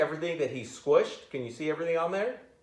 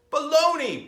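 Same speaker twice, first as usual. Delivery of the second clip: a sharp, much higher, loud bark; 14 semitones higher, 7 dB louder than usual. Acted. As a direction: snap it out loud and high like an order. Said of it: "barked the mayor".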